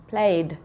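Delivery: played as one syllable ending in D; teacher modelling the D at the end of played; D sound. Shown as a demonstration. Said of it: "there's the D sound".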